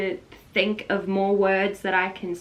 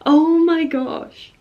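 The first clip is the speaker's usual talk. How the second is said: slow, higher and louder; real awed excitement; overwhelmed; 8 semitones higher, 8 dB louder, slower than usual. Real, not acted. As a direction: savour the shock. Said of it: "I'm really bloody excited".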